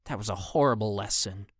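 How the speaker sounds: sneering. A narrator's reading. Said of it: "a boy sneered".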